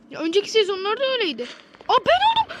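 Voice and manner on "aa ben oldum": high, loud startled cry; real surprise at becoming the killer; surprised shout. Real, not acted. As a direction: yelp it out in shock.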